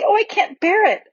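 put-on high, anguished little-girl cry; overwhelmed, high-voiced plea; distressed child. A narrator's reading. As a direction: wail it in distress, pitched far up. Lucy distressed by the pulling.

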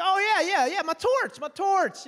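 high, loud mock enthusiasm; hammed-up eager agreement; overeager bluffing. Bluffing about owning a torch.

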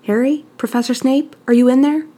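put-on boy calling nervously through the door, worried. A narrator's reading. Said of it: "Draco's worried voice called out".

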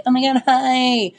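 high, breathless celebrity greeting fans; gushing celebrity. Retold or imitated. A celebrity stepping off her plane, gushing high. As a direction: wave to the crowd like a starlet. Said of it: "when you see a celebrity getting out of a plane".

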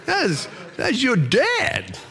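mock-outraged father, high and stretched out at the end; incredulous dad. Dad's incredulous reaction to son.

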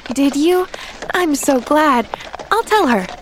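put-on high, glad girlish response; contented. A narrator's reading. Girl is glad the doctor agrees, high and contented. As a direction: respond with eager pleasure. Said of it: "the little girl contentedly".

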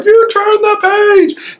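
high, squealing monster glee; excited Grover. Retold or imitated. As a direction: squeal it like a thrilled puppet monster. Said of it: "he gets all excited".

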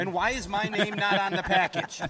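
indignant, loud, mock complaint; mock outrage. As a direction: demand it with fake outrage. Mock outrage about credit.

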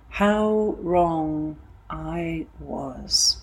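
drawn out very slowly, heavy with regret; slow rueful aside. Real, not acted. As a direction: let each word hang, as if still stung by it. Rueful reflection on her past belief.

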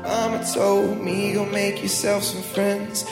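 ordinary talk, nothing marked

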